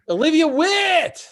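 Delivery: high, slow, drawn-out name call; big announcer intro. Acted. Host hyping the next comedian, announcer call. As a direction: sell the name like a game show host.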